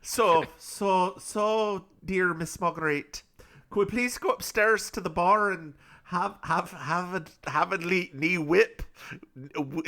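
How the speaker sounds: lightly put-on Scottish brogue; mock Scottish accent. Scottish-accented Irishman inviting Miss Marguerite upstairs, mock Scottish brogue. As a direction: play a Scottish gent in a hammed-up Scottish lilt. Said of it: "he's Scottish instead of Irish".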